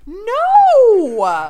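high, loud cry of disbelief; a sudden shriek of real surprise; shocked no. Real, not acted. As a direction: blurt it out in shock. Stunned reaction to proposal story.

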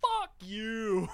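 yelled high and loud; mock outburst, high and loud; mock angry shout. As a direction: bark it like a sudden angry gamer. Josh's cursing imitated.

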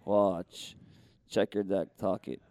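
very low deadpan magician; David Blaine. Acted. Street magician sets up the reveal for a spectator, low impression. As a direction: instruct mysteriously in a deep, unhurried voice. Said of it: "give Ollie the voice of".

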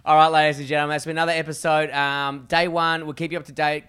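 presenter closing the episode; vlog sign-off. Acted. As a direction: wrap up the show for viewers. Signing off the episode.

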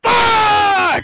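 high, loud shouted curse; panicked shout. Retold or imitated. Caught off guard in bed, a high loud shout. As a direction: blurt it out in sudden alarm.

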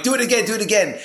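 high, loud, amazed; like a kid after a magic trick; excited. Retold or imitated. The speaker begging the priest to repeat the trick, excited and high. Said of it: "I'm like".

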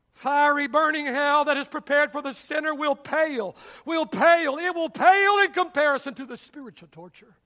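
loud, high preaching cry; fiery sermon shout; full-force preaching. Real, not acted. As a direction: thunder it from the pulpit.

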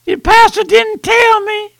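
high whining lost-soul voice; mock the sinner's high complaint from the flames; wailing sinner. Sinner in eternal flame blaming the pastor, high wail.

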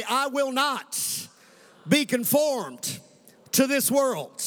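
"be conformed" drawn out very slowly; slow call and response. Shown as a demonstration. Preacher leading the congregation in saying it aloud, slow and deliberate. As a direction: lead the church word by word. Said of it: "let's say this out loud".

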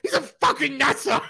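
high, loud and laughing; excited laughing shout. Retold or imitated. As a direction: blurt it out overjoyed. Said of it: "I went".

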